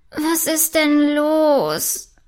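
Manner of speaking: slow, drowsy mumble, just woken; groggy princess roused from sleep, murmuring slowly; sleepy murmur. A narrator's reading. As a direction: drag it out half asleep. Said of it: "murmelt diese verschlafen".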